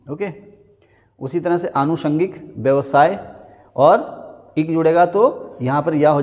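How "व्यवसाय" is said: ordinary delivery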